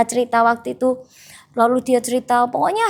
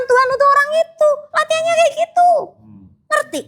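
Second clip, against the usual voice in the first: high, ringing exhortation; preaching climax; 13 semitones higher, 4 dB louder, faster than usual. Acted. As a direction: lift it up to drive the point home.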